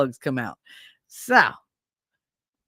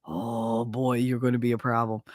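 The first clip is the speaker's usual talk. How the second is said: low, softer aside to oneself; low, wry foreboding; mock ominous realization; 8 semitones lower, 6 dB quieter than usual. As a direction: dread the trouble ahead, under the voice. Sizing up her defiant child.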